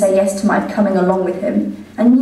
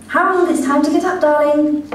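higher, sing-song mother calling her son; doting mother voice; 6 semitones higher than usual. Acted. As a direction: coo at your grown son like a fond mother. Mother voice in told story.

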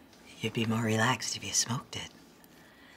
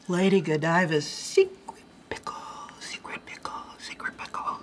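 sultry tease; 7 semitones higher, 4 dB louder than usual. Acted. Sultry teasing pickle reveal.

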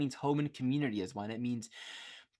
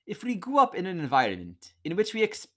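a failed try at a British accent, higher and louder; attempted British accent; 6 semitones higher, 5 dB louder than usual. Shown as a demonstration. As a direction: reach for a British accent and miss it. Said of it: "I'll read it with a British accent".

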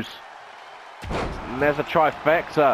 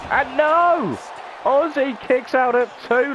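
excited shout; 7 semitones higher than usual. Real, not acted. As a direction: yell it like the crowd just erupted. Kickout at two, live call.

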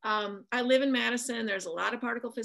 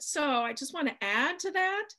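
attempted British accent, higher pitch; 6 semitones higher than usual. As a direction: have a shaky go at a polite British accent. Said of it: "in a very nice British accent".

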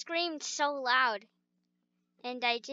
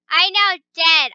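mock dramatic; 10 dB louder than usual. Playing dead after screaming.

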